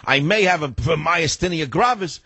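high, drawn-out fretting; lightly mock worrying over every ache; mock hypochondriac. Mocking people fretting over ailments.

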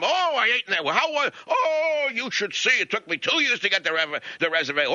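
mocking, high-pitched restaurant bragger; gushing diner. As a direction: gush in a high mock voice, stretching the oohs.

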